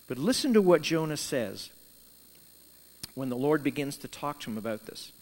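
usual speaking voice

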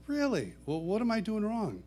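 high, incredulous asking back; surprised question. Retold or imitated. Singer asks his young critic, surprised.

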